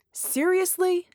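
high incredulous scoff. Acted. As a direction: scoff at the idea. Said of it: "Red scoffed".

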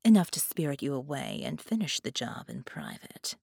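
plain reading voice

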